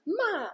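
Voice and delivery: Ma! dropping sharply, loud and high; fourth tone sharp. Shown as a demonstration. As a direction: bark ma like a command. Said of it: "like when I catch my dog with my shoe".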